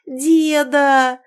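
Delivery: loud, high, drawn-out cry; joyful granddaughter's high shout; shouting with all her might. A narrator's reading. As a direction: call out to Grandpa at full voice. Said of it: "закричала она, что есть мочи".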